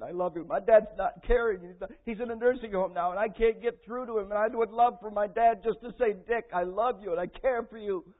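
loud, high, anguished cry of hurt; impassioned high wail, acting out tearful grief; retold sobbing outburst. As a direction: pour out the hurt at full volume, re-enacting the breakdown. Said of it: "I just sobbed and cried".

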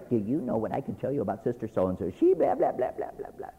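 hushed, sly gossip voice, drifting into a breathy blah blah; gossiping sister. Retold or imitated. A sister whispering gossip about another, hushed. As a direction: lean in and dish the dirt quietly.

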